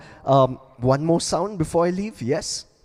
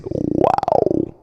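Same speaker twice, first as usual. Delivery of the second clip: very low throaty "Wow" growl; beatbox bass "Wow". Shown as a demonstration. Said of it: "it sounds like this".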